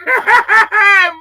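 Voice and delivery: a loud burst of real laughter; sudden laugh. Real, not acted. Mocking the caller, bursting into a loud laugh. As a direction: break into a loud, high laugh.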